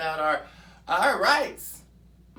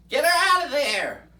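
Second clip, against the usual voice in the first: higher and louder, a sudden angry outburst; angry shout; 7 semitones higher, 8 dB louder than usual. Acted. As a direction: shout her out of office. Ranting against a congresswoman.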